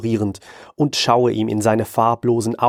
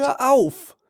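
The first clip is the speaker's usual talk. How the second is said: sharp, much higher demand; demanding stop. Acted. Hans demanding the tentacle man stop, sharp. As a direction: cut him off firmly. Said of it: "fordere ich".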